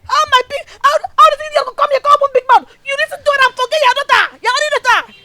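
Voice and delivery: high-pitched, heated rant; angry scolding. Acted. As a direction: have it out with your mother at a yell. Daughter quarrels angrily with mother.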